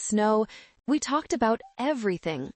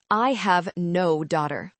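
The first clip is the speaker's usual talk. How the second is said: ordinary delivery, nothing marked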